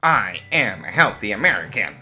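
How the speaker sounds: high, loud mocking imitation; mocking echo. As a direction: parrot her in a mocking voice. Echoes the woman mockingly.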